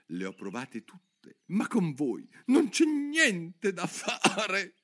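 slow, high, hiccuping lament; the pigeon gasps out its despair; sobbing hiccup. Acted. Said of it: "con una specie di singulto". (il piccione si lamenta con un singulto)

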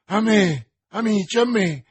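loud, high call for help; alarmed cry. A narrator's reading. D'Artagnan calling friends to his fainting beloved, alarmed cry. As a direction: call your friends over urgently. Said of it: "gridò D'Artagnan".

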